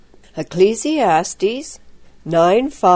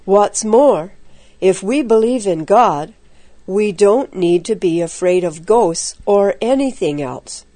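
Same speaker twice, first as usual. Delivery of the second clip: plain reading voice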